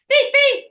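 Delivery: two high, loud mock beeps; imitated censor bleep. Shown as a demonstration. Co-host avoiding rude words for YouTube, imitated censor beeps.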